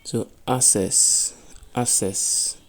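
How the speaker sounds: "access" said slowly and loudly; sounding out. Shown as a demonstration. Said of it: "that's how it's going to be pronounced".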